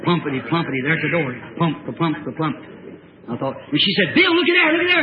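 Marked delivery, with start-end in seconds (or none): 4.1-5.0 high, urgent cry; imitated alarm. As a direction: cry out as the startled woman. Retold wife alarmed at the door.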